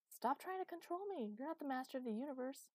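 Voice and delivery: high, indignant outburst; defiant protest. A narrator's reading. Chloe refusing to be controlled by Master, defiant. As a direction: push back, pitch shooting up. Chloe snaps back in quarrel.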